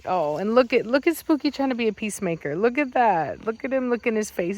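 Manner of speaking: ordinary talk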